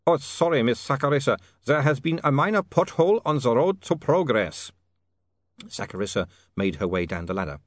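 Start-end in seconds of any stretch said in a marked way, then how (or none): 0.1-4.7 put-on higher, formal Ubervaltian-accented apology; a flustered Ubervaltian gentleman, Ubervaltian accent; Otto's Ubervaltian accent. Otto apologising to Saccharissa, Ubervaltian accent.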